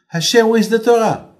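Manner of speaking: high, loud insistent demand; Satan demanding. Acted. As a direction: call up to God, insisting. Satan demands again of Hashem.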